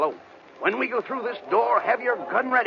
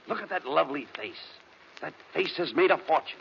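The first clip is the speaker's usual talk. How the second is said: much lower and softer, lovestruck; dreamy admiration; 8 semitones lower, 7 dB quieter than usual. Acted. Abbott gazing at the singer's face, hushed and dreamy. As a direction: murmur it like you are enchanted.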